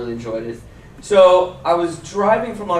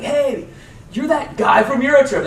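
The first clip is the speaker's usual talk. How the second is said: high, loud excited fan voice; mock fanboy squeal; 10 semitones higher, 5 dB louder than usual. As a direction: gush like a starstruck college kid. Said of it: "he's like".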